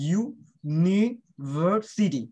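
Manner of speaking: University very slowly, split ইউ-নি-ভার-সিটি; slow teaching breakdown of university; syllable by syllable. Shown as a demonstration. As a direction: sound out university part by part, with gaps. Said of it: "ইউ একটা syllable".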